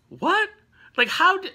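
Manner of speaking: high-pitched disbelief; incredulous. Real, not acted. Reader reacting to the professor's claim, incredulous. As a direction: balk at it, voice jumping way up. Narrator incredulous at Charles's explanation.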